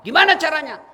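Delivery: loud, high rhetorical question; emphatic question. Real, not acted. Preacher challenges the congregation, loud and high. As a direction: hit the question hard. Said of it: "preacher rhetorical question".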